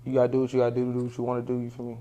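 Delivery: normal talking voice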